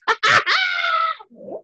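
shrieking high laugh; real high-pitched burst of laughter. Real, not acted. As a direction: crack up at your own punchline. Laughing at retold insult.

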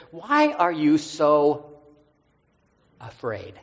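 slowed right down, each word drawn out; slow solemn question. Retold or imitated. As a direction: ask it slowly, gently probing. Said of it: "Jesus says".

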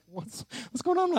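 high, startled worried voice; panicked question. Retold or imitated. Pastor mimicking his own panic, high and alarmed. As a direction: squeak it out in alarm. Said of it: "I'm like".

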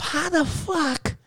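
high, slow, amazed self-question; retold astonishment, slowed and up high; stunned disbelief. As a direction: ask yourself in high disbelief. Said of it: "And I said to myself".

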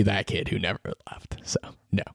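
half-joking whisper to yourself; whispered aside. Real, not acted. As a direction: confide it under your breath. Self-mocking aside about Syracuse.

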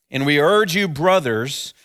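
ordinary delivery

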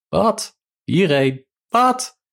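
high, questioning calls; calling out. A narrator's reading. Two lovers call to each other from afar, high calling out. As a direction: shout short questions across, pitched very high. Shouted exchange across a distance.

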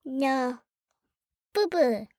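put-on small, soft baby talk. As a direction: answer like a little baby, softly. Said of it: "that was the baby voice".

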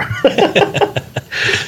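breathless high giggle; airy embarrassed laugh. Real, not acted. Laughs after apologizing for burp.

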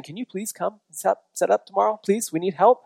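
high-pitched, mildly desperate plea; mock pleading request. Acting out pleading late-night texts.

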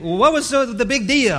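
high, loud incredulous question; mock disbelief. Preacher voicing a skeptic, high and loud. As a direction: scoff at it like a puzzled listener. Imagined listener objecting aloud.

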